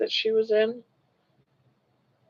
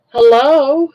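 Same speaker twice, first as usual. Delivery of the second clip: high, loud rising Hello?; loud questioning hello to a newcomer; calling hello; 6 semitones higher, 10 dB louder than usual. Real, not acted. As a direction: call out Hello? as if across a room. Calling out to a viewer.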